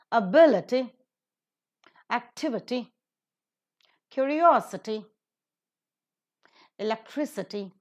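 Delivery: ability, activity, curiosity, electricity, equality, generosity, possibility, probability, stressed third from last; a-BIL-ity, ac-TIV-ity, curi-OS-ity, e-QUAL-ity, word by word; antepenultimate stress. Shown as a demonstration. Teacher models I T Y words, ability to probability stressed third from last. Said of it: "they take up the stress in the antepenultimate syllable".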